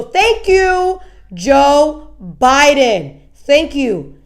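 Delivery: slow, stretched-out mock tribute; mock gratitude. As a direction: drag out each word of exaggerated thanks. Mock praise to Biden.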